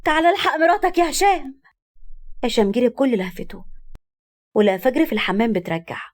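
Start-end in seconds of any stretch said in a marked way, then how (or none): 0.0-1.6 much higher, calling out in alarm; a frightened cry from the doorway; alarmed scream. A narrator's reading. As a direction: yell for him to come quickly. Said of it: "ولما فتحت الباب صرخت".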